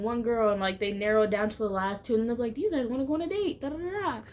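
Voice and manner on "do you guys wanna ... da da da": quick, much higher breezy host voice, rattled off fast; a light send-up of a cheery producer rushing the question; high and fast, hammed-up host patter; mock game-show host. Producer asking the final two.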